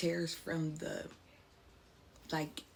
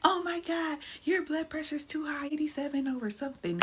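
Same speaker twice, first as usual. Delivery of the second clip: high, urgent mock-panicked nurse; alarmed nurse; 9 semitones higher than usual. Mom mimics nurse noticing high blood pressure, high alarmed voice. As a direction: rush the numbers like an alarmed nurse. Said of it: "I hear".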